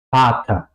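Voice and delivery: "Vater" starting on an f; V alemana como F. Shown as a demonstration. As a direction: say "Vater" as "fata". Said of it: "Suena más como una F". ("Vater" con sonido de F inicial)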